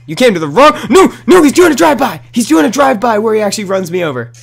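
high, loud alarmed shouting; yelling in panic as he gets run over; panicked yell. Real, not acted. Panic as enemy runs him over.

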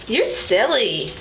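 ordinary delivery

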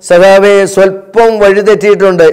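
ordinary talk, nothing marked

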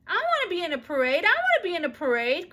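high, loud excited chorus; children begging together; eager cry. A narrator's reading. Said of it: "cried the others".

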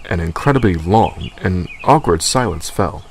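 plain reading voice

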